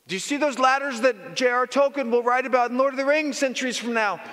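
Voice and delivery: high, barking boss voice; impatient commander calling up to the wall; boss questioning. Acted. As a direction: play the demanding boss up high.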